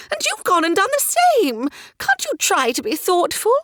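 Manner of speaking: high, excitable lady's voice; fussy society mother. A narrator's reading. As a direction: chatter like a flighty mother of the ton.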